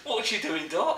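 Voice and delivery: high, put-on London accent. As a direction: tease it out in mock London. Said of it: "Dot from EastEnders".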